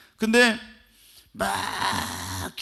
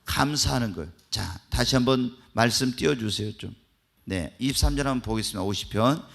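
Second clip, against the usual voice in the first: ordinary delivery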